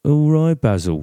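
high, loud cry that drops on the name; playful British movie-character impression; Austin Powers. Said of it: "I think in Austin Powers".

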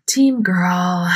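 mock-glum, drawn-out delivery; super sad. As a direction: imitate a disappointed mom. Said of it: "Like, super sad about it".

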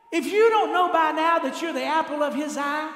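preaching at full force. Acted. Pastor assuring the church of God's love, loud preaching. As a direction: build it up for the congregation.

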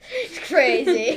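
slow words through laughter; laughing. Real, not acted. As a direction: laugh it out slowly. Laughing repeat of friend.

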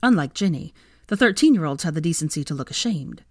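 ordinary delivery

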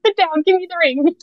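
high, loud, gushing yes; giddy excitement. Retold or imitated. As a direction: blurt it out, too thrilled to wait. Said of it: "And so I was just like".

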